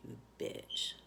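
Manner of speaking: bitter whispered curse under the breath; a hushed aside aimed at the daughter; whispered insult. Real, not acted. Muttered insult at daughter's act.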